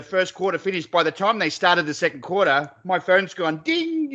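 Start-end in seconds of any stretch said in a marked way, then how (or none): 3.6-4.1 long high sung-out ding; imitated phone chime. Telling mate about the PayPal alert, bright chime imitation. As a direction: mimic a notification bell.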